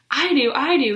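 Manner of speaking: desperate to be picked; boy shouting to answer the teacher; shouted. Acted. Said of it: "he shouted".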